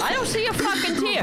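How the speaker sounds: high, fast, indignant cry; exasperated outburst. Real, not acted. Host heckling a tearless crier on video, exasperated outburst. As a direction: burst out over the clip in disbelief.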